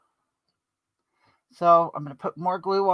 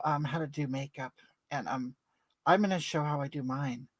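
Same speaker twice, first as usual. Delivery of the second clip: ordinary talk, nothing marked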